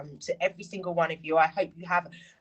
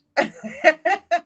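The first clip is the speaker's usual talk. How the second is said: high bright burst of laughter; loud laugh; 12 semitones higher, 8 dB louder than usual. Real, not acted. After confessing her love of roasts, a loud laugh.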